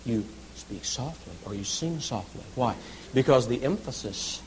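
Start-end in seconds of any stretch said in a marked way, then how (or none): 0.0-2.4 hushed pianissimo delivery; much softer, lower voice; speaking softly. Shown as a demonstration. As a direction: drop right down to soft. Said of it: "If it says piano or pianissimo".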